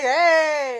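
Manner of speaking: loud, high drawn-out "hey"; drunk heckler. Retold or imitated. Drunk heckler answering back, loud mock holler. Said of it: "And he was like".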